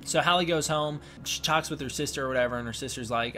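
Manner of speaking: normal talking voice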